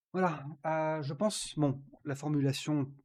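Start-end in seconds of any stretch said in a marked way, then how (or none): none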